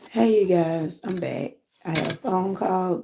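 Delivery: ordinary talk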